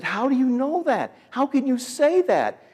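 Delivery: high-pitched, pleading objection; put-on high voice, dragging out the challenge; imitated upset protest. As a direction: mimic a hurt woman protesting. Imitates attendant protesting his verdict.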